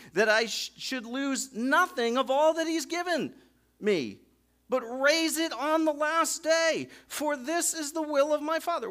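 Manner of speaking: high, loud sermon delivery; preaching. Acted. Pastor expounding the Father's will, impassioned preaching. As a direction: preach it out to the room.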